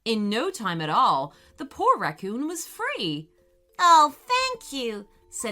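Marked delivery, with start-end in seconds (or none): 3.8-5.1 loud, slow thanks; grateful. Acted. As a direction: thank them gladly. Harold thanks woodpecker.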